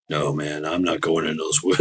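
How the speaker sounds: low hushed dread; spooked guy. Retold or imitated. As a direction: friend imitating the scared partygoer.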